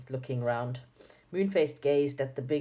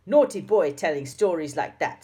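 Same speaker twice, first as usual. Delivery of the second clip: put-on louder scolding as a stern old schoolmistress; loud frightening voice; 7 dB louder than usual. Dame Snap scolding Joe for lying, loud. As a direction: scold the boy loud enough to make him jump. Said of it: "in such a loud and frightening voice".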